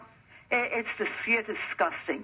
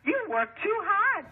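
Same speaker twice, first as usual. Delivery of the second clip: high, slow sneering mimic; a high, drawn-out mock voice; mocking imitation; 11 semitones higher than usual. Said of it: "you know what they told her".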